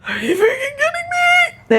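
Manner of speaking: high, strained, near-crying disbelief; mock-despairing mom losing it; exasperated outburst. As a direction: wail it like you've hit your limit. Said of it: "And I was just like".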